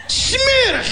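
high, disgusted yelp; disgusted outburst. Retold or imitated. Retelling his first bite at the in-laws, disgusted high yelp. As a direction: spit out the word in high disgust. Tasting the food.

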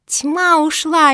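high, loud, drawn-out rejoicing; jubilant. A narrator's reading. Mafi rejoices at darkness leaving, jubilant. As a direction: cheer it out slowly. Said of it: "ликовала Мафи".

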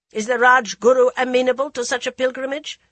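put-on loud, harsh old queen. A narrator's reading. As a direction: challenge them sharply. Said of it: "The Dowager's harshness".

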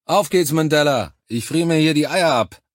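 loud, high complaining call; impatient shout. A narrator's reading. Freezing soldier urging comrade to lift, impatient shout. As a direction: hurry a comrade along.

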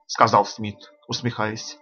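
ordinary delivery

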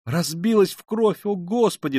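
high, drawn-out cry of alarm; horrified cry. A narrator's reading. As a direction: cry out in horror over the fallen woman. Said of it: "вскрикнула Соня". (в ужасе вскрикнуть)

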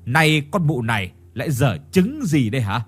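ordinary delivery, nothing marked

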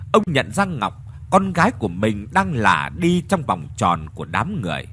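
ordinary talk

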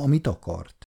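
ordinary delivery, nothing marked

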